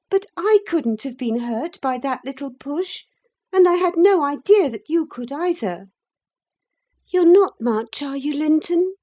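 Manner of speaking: high, loud urgent plea; high girl pleading; anxious pleading girl. A narrator's reading. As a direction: beg him to answer.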